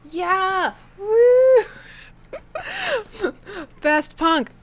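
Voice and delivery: high whooping cheer, hammed up; Canadian punk fan whooping; mock punk cheer.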